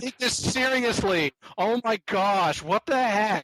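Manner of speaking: high, slow, drawn-out protest; hammed-up exasperated plea; mock exasperation. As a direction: draw out each word in put-on outrage. Mock protest amid co-hosts' laughter.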